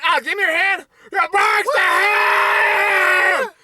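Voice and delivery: high, drawn-out hammed-up scream; high mock shriek. Storyteller acting out a broken hand, high mock shriek. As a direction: shriek through it, stretching the end.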